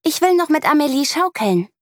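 put-on high, stubborn little girl; girl on the swing refusing her friend, high and stubborn; child insisting. A narrator's reading. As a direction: whine it slightly, wanting your way. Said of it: "antwortet Lea".

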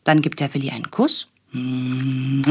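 ordinary delivery, nothing marked

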